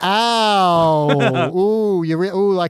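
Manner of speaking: overdone ouch, stretched out slowly; mock pain. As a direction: play a kid who suddenly feels his leg hurt. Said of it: "I kinda wanted Brandon to go".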